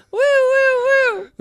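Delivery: high wailing siren; siren sound. Pretend. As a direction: imitate a police siren. Sound effect for police.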